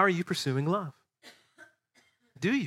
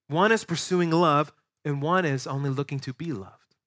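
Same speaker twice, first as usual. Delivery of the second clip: normal talking voice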